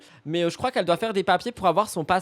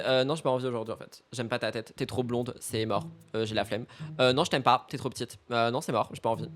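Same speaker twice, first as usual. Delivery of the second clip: bored clerk; 7 semitones lower, 7 dB quieter than usual. Retold or imitated. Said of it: "il te dit".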